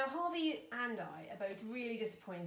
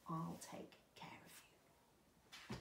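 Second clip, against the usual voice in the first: tender reassurance; 2 semitones lower, 9 dB quieter than usual. Acted. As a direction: promise it softly, almost whispering. Izzy comforts Skunk as story ends.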